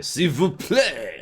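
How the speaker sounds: put-on slow, high, loud French accent; drawn-out French accent phrase. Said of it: "One of them will say".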